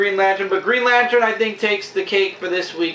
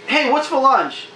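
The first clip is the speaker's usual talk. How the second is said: higher, mock-casual question; sudden casual switch; 6 semitones higher than usual. Warren abruptly drops his tantrum to ask about lunch, mock-casual. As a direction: toss it out lightly, pitched up. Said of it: "then he just stops dead".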